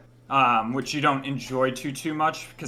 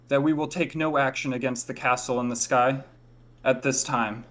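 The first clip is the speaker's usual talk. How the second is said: formal proclamation; 3 semitones higher than usual. Acted. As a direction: proclaim to the whole square. Laurel addresses the town square.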